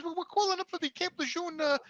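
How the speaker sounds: lawyer's caller, high and soft; lady caller. Retold or imitated. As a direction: pitch up for the woman on the line. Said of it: "she says".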